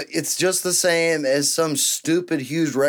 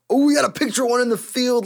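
excited; 12 semitones higher, 3 dB louder, faster than usual. Real, not acted. Spots something exciting in clip.

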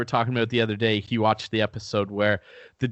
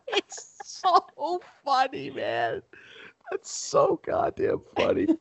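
high squeaky laughing words; slower than usual. Real, not acted. Laughing at the Dean bit.

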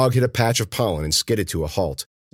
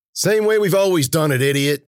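high, loud, irritated jab; exasperated retort; 9 semitones higher, 5 dB louder than usual. Acted. Warner answering his partner's question, exasperated. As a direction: fire it back raised and impatient.

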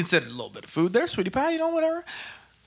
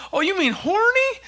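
mocking quote. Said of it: "And he goes".

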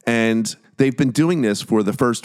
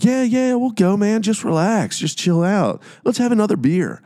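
higher, sing-song placating voice; mock Dahmer coaxing; 11 semitones higher than usual. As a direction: coax him to stay in a high put-on voice. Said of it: "And Dahmer's like".

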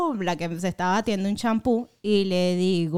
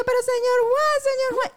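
much higher, insistent calling; 3 dB louder than usual. Retold or imitated. Calling señor Juan who gives no reaction, high and insistent. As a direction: call his name, puzzled he won't answer.

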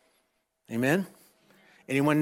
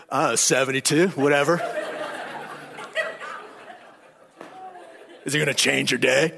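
a touch of sulky, deadpan Siri; bitter voice; 3 semitones lower than usual. Pretend. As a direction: answer like a bored, bitter assistant. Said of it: "if Siri had a bitter voice".

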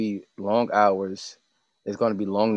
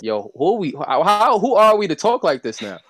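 rising into high, louder mock disbelief; playful, high-pitched incredulity; amazed disbelief; 7 semitones higher, 4 dB louder than usual. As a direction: act astonished at your own big talk. Voicing amazement at their own success.

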